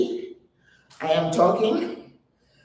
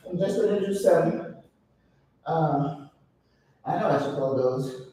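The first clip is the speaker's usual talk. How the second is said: usual speaking voice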